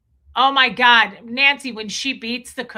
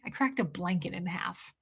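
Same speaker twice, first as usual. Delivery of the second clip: soft, low deadpan; quiet deadpan quote; 3 semitones lower, 8 dB quieter than usual. Retold or imitated. Quoting the blonde lady to chat, soft deadpan.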